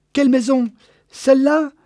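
high, loud urgent questions; frantic shout. Acted. Lonely man racing to find the ringing house, shouting. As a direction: call out, desperately hunting.

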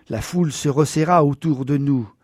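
ordinary delivery

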